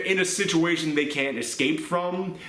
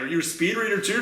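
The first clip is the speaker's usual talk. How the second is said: usual speaking voice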